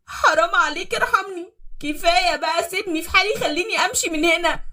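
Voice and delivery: high, loud begging wife; desperate pleading. Acted. Beaten wife begging husband for mercy. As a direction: beg him to let her go. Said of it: "بتعيط بوجع".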